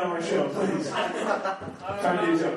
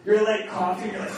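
ordinary delivery

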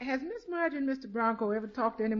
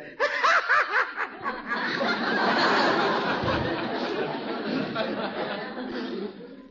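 long, breathy delighted chuckle; a drawn-out pleased laugh; 3 dB louder than usual. Acted. Bertie delighted at the idea.

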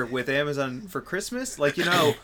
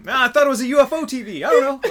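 higher, louder, quick and laughing; laughing excuse; 12 semitones higher, 10 dB louder, faster than usual. Acted. Drone thief caught with the TV, quick laughing excuse. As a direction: blurt a silly excuse through laughter. Thief's reply in acted exchange.

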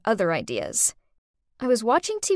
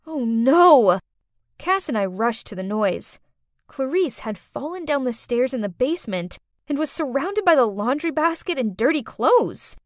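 ordinary delivery, nothing marked

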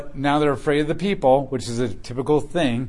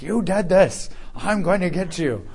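crazy neighbor; 6 semitones higher than usual. Retold or imitated. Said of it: "your crazy neighbor's like".

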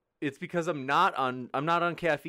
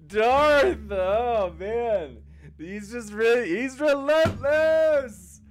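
loud, high, drawn-out amused exclaiming; high-pitched, helplessly amused; cracking up; 9 semitones higher, 9 dB louder, much slower than usual. Real, not acted. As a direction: exclaim while recovering from a laughing fit. Host cracked up over heckler.

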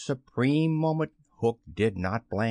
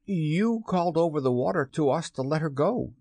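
higher, defensive crewman; protesting pirate; 5 semitones higher than usual. A narrator's reading. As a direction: defend yourself to the captain. Starkey protests to Hook.

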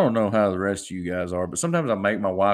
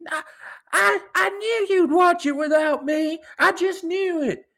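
mock angry wife; 4 dB louder than usual. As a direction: ham up his wife's outrage in a high falsetto. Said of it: "She'd get so".

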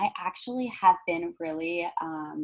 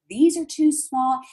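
mock tantrum; 8 semitones higher, 6 dB louder, slower than usual. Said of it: "like, tantrum".

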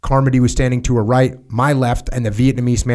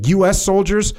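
higher-pitched, pressing officer's demand; angry superior; 9 semitones higher than usual. A narrator's reading. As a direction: read the colonel's anger pitched up. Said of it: "immediately began screaming".

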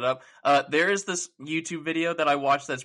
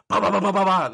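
louder, higher ba-ba-ba gunshot noises; imitated rapid gunfire; 6 semitones higher, 6 dB louder than usual. Imitating Deadeye's fanned revolver shots, loud rapid ba-ba-ba. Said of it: "That's what he does at the end of Deadeye".

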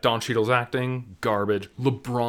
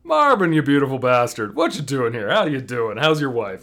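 mock joyful greeting; 7 dB louder than usual. Toons hailing Marvin the Martian, loud hammed-up cheer. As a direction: greet him like a long-lost buddy. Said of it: "everyone's like".

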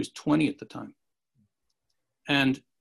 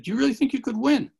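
surprised question; 10 semitones higher, 4 dB louder, faster than usual. Retold or imitated. Narrator asks teacher, high.

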